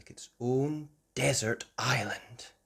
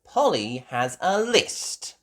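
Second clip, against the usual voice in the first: proud Peppa; 4 semitones higher, 6 dB louder than usual. A narrator's reading. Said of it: "Peppa said proudly".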